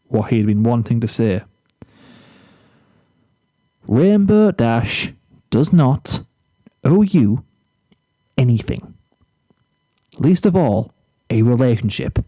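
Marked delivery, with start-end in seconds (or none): 3.9-12.2 firm Flash. Acted. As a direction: lay down the truth calmly but firmly.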